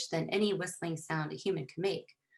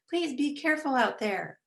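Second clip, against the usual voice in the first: higher, projected plea; yelling back; 7 semitones higher, 4 dB louder than usual. A narrator's reading. Mom yelling back a warning from another room, raised pitch. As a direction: call out a caring warning. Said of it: "Mom yelled back".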